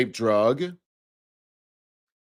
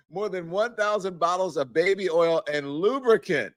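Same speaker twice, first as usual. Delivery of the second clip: reading through giggles, higher pitch; host rereading the seized list while laughing; 6 semitones higher than usual. Real, not acted. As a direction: try to read it straight but laugh through it. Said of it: "I don't mean to laugh".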